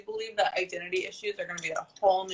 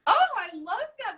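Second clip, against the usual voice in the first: delighted squeal; 13 semitones higher, 3 dB louder than usual. Real, not acted. Reacting delighted to fiance's phrase.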